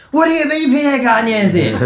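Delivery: high, loud and laughing in disbelief; shocked disbelief. Retold or imitated. Chef hears a famous chef is dining, laughing in shock. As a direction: squeal it through a laugh.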